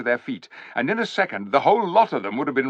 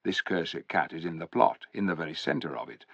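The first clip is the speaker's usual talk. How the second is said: low warning; 5 semitones lower, 7 dB quieter than usual. A narrator's reading.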